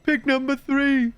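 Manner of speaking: slow and dopey, like a brute; dumb brute voice. Shown as a demonstration. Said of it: "my general dumb brute voice".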